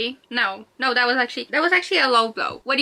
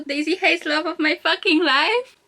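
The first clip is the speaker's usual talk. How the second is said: high, gushing repeat of a favorite line; fangirl gushing; 4 semitones higher than usual. Acted. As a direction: swoon over the quote, pitch climbing. Repeats favourite line, gushing over it.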